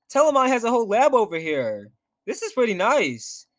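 usual speaking voice

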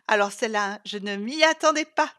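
loud, amused teasing; mocking. A narrator's reading. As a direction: burst out making fun of a friend. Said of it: "se moqua Isa".

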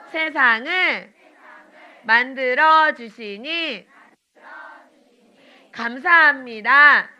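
slow prayer, word by word, pausing; children's prayer. Acted. Teacher leading children in prayer, slow and earnest. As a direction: lead the children through each phrase slowly.

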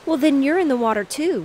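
put-on a girl turning it back on her brother; sharp retort. A narrator's reading. Girl retorts to brother that he's in the water too. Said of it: "retorted Sue".

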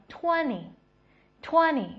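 "twenty" said as "twen-ee", no second t; American silent t. Shown as a demonstration. Teacher showing American twenty to learners, t left silent. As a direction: drop the t after n in "twenty". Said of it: "美式应该读成twenty".

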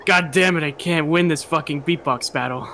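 usual speaking voice

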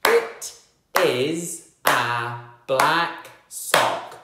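one slow word per clap; slow clapped words. Shown as a demonstration. Teacher clapping each word so pupils join in, slow. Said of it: "Let's clap it".

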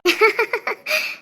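quiet breathy chuckle; soft laugh. Real, not acted. Aunt chuckles at uncle's fear of holding baby. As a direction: laugh it off gently.

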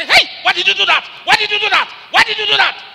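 high, loud repeated protest; frantic man shouting why; alarmed protest. Retold or imitated. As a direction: yell it again and again in alarm. Man protesting after wife falls.

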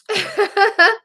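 a bright, high laugh bursting out; high burst of laughter. Real, not acted. Viewer asks about teary eye, she bursts out laughing.

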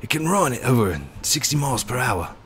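Aussie wildlife presenter pointing things out; Australian accent. Acted. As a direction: wildlife guide showing off the animals in a mild Australian accent. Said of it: "I've lost the accent".

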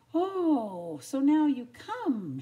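loud, high, slow indignation; angry. A narrator's reading. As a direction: draw it out accusingly. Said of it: "she said angrily".

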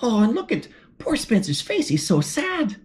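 ordinary delivery, nothing marked

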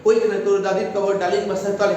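fast, run-on reading of the whole line; rushed reading. Shown as a demonstration. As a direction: rattle it off without the beats. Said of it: "হবে না".

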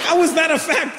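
high-pitched incredulous son; high mock-outraged question; exasperated child. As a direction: demand an answer as a baffled kid. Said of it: "I said".

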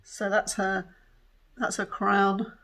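ordinary delivery

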